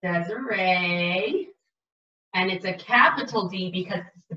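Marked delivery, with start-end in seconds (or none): 0.0-1.5 "Desiree" stretched out slowly, syllable by syllable; slow sounding out. Shown as a demonstration. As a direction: draw out "Desiree" as you spell it.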